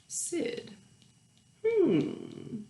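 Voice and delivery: plain reading voice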